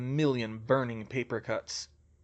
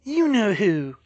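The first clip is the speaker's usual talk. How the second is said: louder, higher snarl at an enemy; hostile, raised snarling voice; 7 semitones higher, 6 dB louder, slower than usual. A narrator's reading. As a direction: snarl the name with contempt. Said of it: "Hermione snarled".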